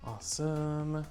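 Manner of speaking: ordinary talk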